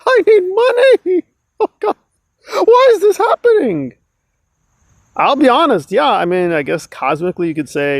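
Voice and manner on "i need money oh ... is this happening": much higher, laughing mock wail; mocking whine. Mocking Nora's pleas for money, a high laughing wail. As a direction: ham up her pleading, pitched way up. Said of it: "And now she went".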